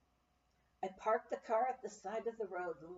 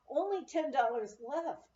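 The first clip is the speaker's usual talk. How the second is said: plain reading voice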